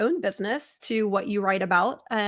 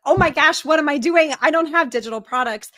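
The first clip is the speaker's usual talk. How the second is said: mildly panicked retelling, raised at first; mock panic; 4 semitones higher, 4 dB louder than usual. Retelling the panic after hanging up the phone, mock alarm. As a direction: imitate a flustered realization, easing off at the end.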